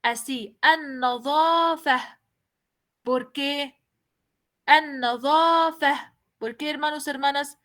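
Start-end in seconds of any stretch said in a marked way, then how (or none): 0.6-2.2 an-nadhafa drawn out, final ta turned to ha; pronounced with ja. Shown as a demonstration. Teacher to students: an-nadhafa slow, ending in h. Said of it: "voy a pronunciarla ja". 4.6-6.1 an-nadhafa drawn out, final ta turned to ha; pronounced with ja. Shown as a demonstration. Teacher to students: an-nadhafa slow, ending in h. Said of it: "voy a pronunciarla ja".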